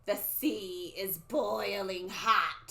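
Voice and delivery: slow, drawn-out prophecy; stretched, ominous crone voice; old lady soothsayer. Acted. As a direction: intone it like a creepy seer. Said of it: "the old lady talking".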